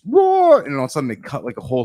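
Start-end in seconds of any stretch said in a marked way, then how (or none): 0.0-0.6 high, loud mock roar; imitated scream. Retelling the movie abductee's torment to friends, imitated scream. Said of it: "And he's screaming".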